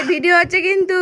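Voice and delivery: loud, high sing-out warning; calling out. Real, not acted. Mother warns the others the video is on, calling out.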